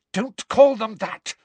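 high, loud, slow rebuke; high-pitched angry protest; furious. A narrator's reading. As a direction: rebuke them furiously, each word weighted. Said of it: "Hermione said furiously".

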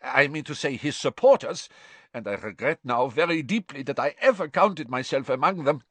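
rushing to explain himself; hurriedly. A narrator's reading. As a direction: Karkaroff anxiously explaining to the court. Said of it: "said Karkaroff hurriedly".

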